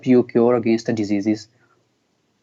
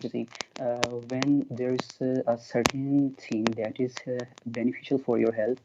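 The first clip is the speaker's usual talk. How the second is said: very soft, hesitant explanation; faint voice with halting pauses; low voice; 11 dB quieter than usual. Real, not acted. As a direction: speak far more quietly, feeling for the words. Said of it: "Your voice became low".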